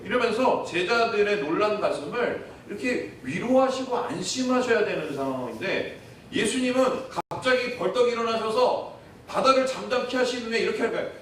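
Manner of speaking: ordinary delivery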